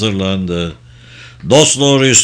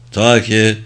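plain reading voice

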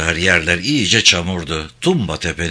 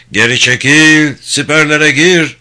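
shouted command; 5 semitones higher, 8 dB louder than usual. A narrator's reading. Officer ordering troops back into trenches, shouting. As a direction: holler it over the battle noise.

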